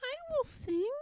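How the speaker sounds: lightly piggy and pert; little pig voice. Acted. Pigwig offers to sing for Pigling, high and pert.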